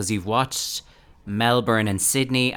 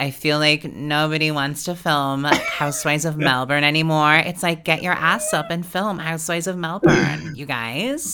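higher-pitched celebrity parody; Kim Kardashian; 7 semitones higher than usual. Acted. Cohost adapts the celebrity quote to Housewives of Melbourne, high mock scolding. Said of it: "Kim Kardashian, formerly West".

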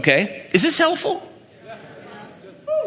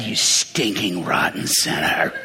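low, slow granny scolding a sinner; 10 semitones lower, slower than usual. Retold or imitated. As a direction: growl it out like a disapproving old church lady. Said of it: "you could sort of add".

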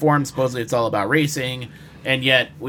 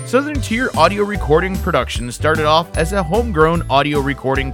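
upbeat, bright radio ad read; commercial read. Acted. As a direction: sell it like a radio spot. Reads ad for Star Productions.

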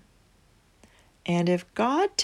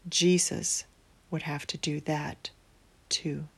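quiet, solemn; 3 semitones lower, 8 dB quieter than usual. A narrator's reading. Solemn ending, voice drops.

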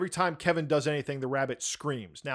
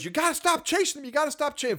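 wife's voice; 12 semitones higher, faster than usual. Retold or imitated.

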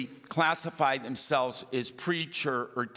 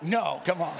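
usual speaking voice

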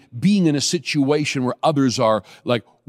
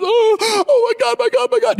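much higher, louder mock wail of panic; imitating sobbing man; 4 dB louder than usual. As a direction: cry it out like the terrified man beside you. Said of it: "he's sobbing".

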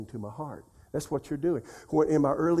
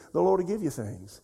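ordinary talk, nothing marked

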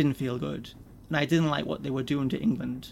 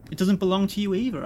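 sharp, louder retort; snapped; 5 semitones higher, 5 dB louder than usual. Acted. Richard snapping back at Mason over who owns the train. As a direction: bite the words back at him. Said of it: "I snapped".

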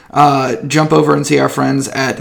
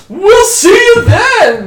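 very high, loud, stretched-out farewell; hollered sign-off; 9 dB louder, much slower than usual. Pretend. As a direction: holler a goodbye in a silly high voice. GM hollers mock-cheery sign-off.

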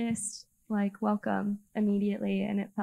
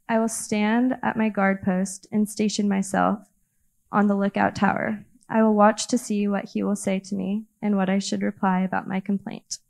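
loud, earnest Bible reading; scripture reading; 6 dB louder than usual. Acted. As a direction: read it out boldly to the church.

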